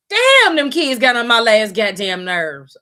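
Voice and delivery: loud, slow exasperated rant; exasperated outburst. Real, not acted. Venting to viewers about annoying teen characters, loud and slow. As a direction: drag it out, fed up and loud.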